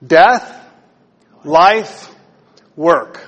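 Death, life, work each hit loud and separate, rising; punched key words. Shown as a demonstration. Pastor teaching the congregation three words, punched one by one. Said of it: "can you all say that together".

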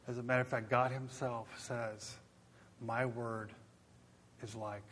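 soft, low, fading appeal; hushed preaching. Real, not acted. Preacher closing the sermon in a hushed appeal. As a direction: draw them in quietly.

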